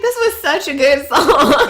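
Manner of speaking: loud, high, drawn-out outburst through laughter; retold outcry, stretched out and laughing; laughing exclamation. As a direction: exclaim it while cracking up. Laughing retold reaction to song.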